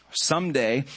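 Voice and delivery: usual speaking voice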